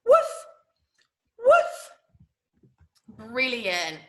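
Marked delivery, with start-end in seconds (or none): 0.1-1.9 high, loud, short bark; sudden high-pitched woof; little dog woof. Shown as a demonstration. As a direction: yap like a small dog. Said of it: "Make a little woof sound".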